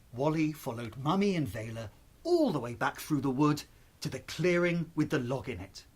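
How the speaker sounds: usual speaking voice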